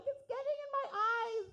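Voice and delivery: high, squeaky mock protest; indignant retort. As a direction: squeal it back in defence.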